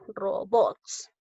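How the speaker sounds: "Robots" split, weight on BOTS; stress on bots. Shown as a demonstration. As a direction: highlight the last syllable of "Robots". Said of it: "vamos a resaltarlo".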